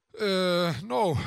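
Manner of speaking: hesitant drawn-out filler, then a blurt; lost for words. Retold or imitated. Grandma facing her daughter, asked for a compliment, lost for words. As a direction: imitate someone stuck for words. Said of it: "dat ze niks wist te zeggen".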